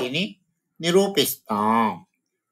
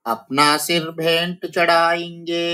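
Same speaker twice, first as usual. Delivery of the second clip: raised, sing-song recital of the line; poem recitation; 6 semitones higher than usual. Shown as a demonstration. Teacher reciting the poem line before explaining it, sing-song. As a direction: chant it like verse. Recited, then repeated plainly.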